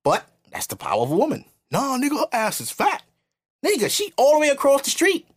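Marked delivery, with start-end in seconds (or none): 1.7-5.3 high-pitched mock amazement; pitched-up acted street chatter; imitated catcaller. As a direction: mimic an excited man reacting to a woman. Imitates men hollering at women.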